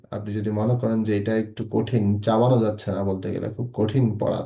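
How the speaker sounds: usual speaking voice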